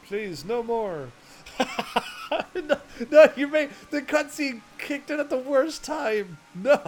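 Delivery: cracking up mid-line, pitch jumping high; laughing. Real, not acted. Streamer caught by a badly timed cutscene, real helpless laughter. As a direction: burst out laughing through the words.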